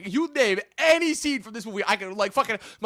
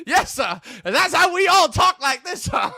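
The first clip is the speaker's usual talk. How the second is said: mock shouted quote; 6 semitones higher than usual. Podcaster acting out a movie character, hammed-up and shouting. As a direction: laugh and bark out the sir. Quoting Brandon T. Jackson character.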